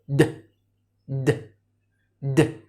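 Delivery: "د" as a short low D sound, "duh"; letter sound demo. Shown as a demonstration. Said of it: "الدي بنطقه إيه؟". (نطق "د" كصوت حرف D قصير ومنخفض)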